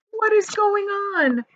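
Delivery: high startled question; jolted awake and confused; boy crying out from his hammock; alarmed cry. A narrator's reading. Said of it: "cried James".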